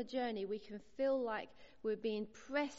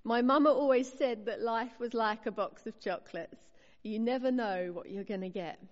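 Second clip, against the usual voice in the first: Forrest Gump line; 8 dB louder than usual. Retold or imitated. Preacher quoting Forrest Gump to the congregation, louder mock Southern accent. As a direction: quote the movie line in a hint of a Southern accent. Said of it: "I'll let you hear the accent in your head".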